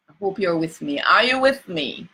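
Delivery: normal talking voice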